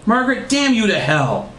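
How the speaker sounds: high strained mock yell; hammed-up high outburst; exasperated curse. A narrator's reading. Dad cursing at Margaret.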